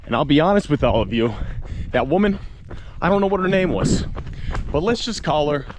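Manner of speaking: high and loud, gossiping with glee; hammed-up storyteller. Vlogger telling viewers the legend, hammed-up and gleeful. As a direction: spill the village legend like juicy gossip.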